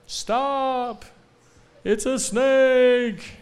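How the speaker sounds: high, loud warning call; warning shout. Pretend. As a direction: call out to stop them from the snake. Bill warning someone near a snake.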